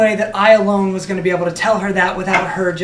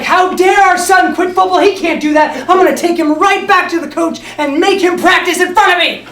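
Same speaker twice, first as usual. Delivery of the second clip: high, loud indignant rant; outraged mom; 9 semitones higher, 5 dB louder than usual. Retold or imitated. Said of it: "she's like".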